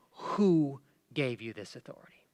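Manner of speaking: low, whispery retold question; hushed challenge. Religious leaders questioning Jesus's authority, hushed challenge. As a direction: let the challenge drop to a hush. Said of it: "They said".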